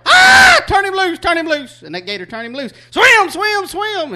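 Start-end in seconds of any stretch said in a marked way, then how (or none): 0.1-1.8 high-pitched panicked squeal. Retold or imitated. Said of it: "said he squealed like a little girl". 2.9-4.1 shouting. Retold or imitated. Brother yelling at the dog.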